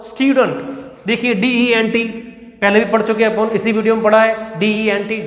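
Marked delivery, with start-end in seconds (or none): 0.0-0.6 स्टूडेंट as styoo-dunt, falling at the end; correct pronunciation. Shown as a demonstration. Teacher repeating the answer for students, styoo-dunt. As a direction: say स्टूडेंट with yoo and reduced dunt. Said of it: "क्या होगा दोस्तों".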